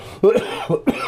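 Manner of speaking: high-pitched burst of laughter; real laugh. Real, not acted. Host caught off guard by co-host's teeth jab, real high laugh. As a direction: crack up laughing.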